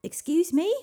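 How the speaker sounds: loud, high-pitched shout; high hail held up at the end; calling out. A narrator's reading. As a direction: call down into a ravine. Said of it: "shouted Avalon".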